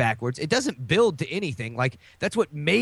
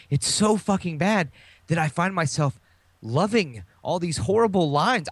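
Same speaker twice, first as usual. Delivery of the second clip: usual speaking voice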